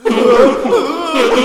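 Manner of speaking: loud high laughing fit; burst of laughter. Real, not acted. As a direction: crack up at your own joke. Laughing at own word.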